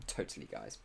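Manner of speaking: deadpan sarcasm, dropped low and soft; sarcastic. Real, not acted. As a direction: say it dry, admitting the opposite. Sarcastic about poor preparation.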